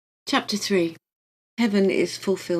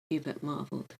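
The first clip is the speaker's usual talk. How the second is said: usual speaking voice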